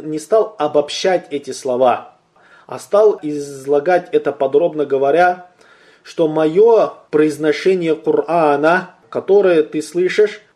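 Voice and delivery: ordinary talk, nothing marked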